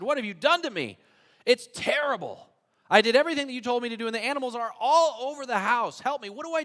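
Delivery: high, loud, frantic begging; pleading in a panic for help; a man at his wits' end crying to the rabbi; desperate plea. Acted.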